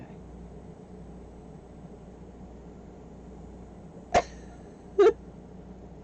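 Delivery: silent, high-pitched wheeze of laughter; high wheezing laugh. Real, not acted. Recalling the fake mafia man, a high wheezing laugh. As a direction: laugh until no sound comes out.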